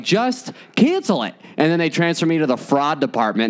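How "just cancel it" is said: high, slow, strained begging; desperate to end the call now; exasperated plea. Retold or imitated. Son begs the bank to cancel in front of mom, high and strained. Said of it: "And then I was like".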